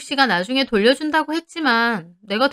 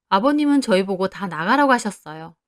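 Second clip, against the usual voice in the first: usual speaking voice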